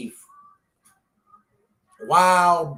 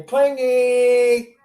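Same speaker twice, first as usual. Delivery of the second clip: put-on coaxing baby voice; 7 semitones higher, 6 dB louder than usual.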